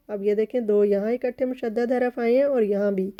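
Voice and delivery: normal talking voice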